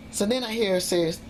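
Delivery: ordinary delivery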